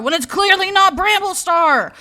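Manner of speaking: loud, high frustrated rant; dragging out fucking dust. Real, not acted. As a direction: tear into the character. Ranting at the leader cat.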